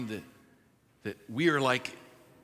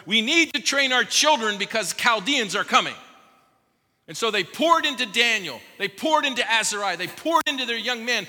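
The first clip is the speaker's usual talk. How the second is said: impassioned preaching; 5 semitones higher, 5 dB louder than usual. Real, not acted. Parents urging, preacher building up.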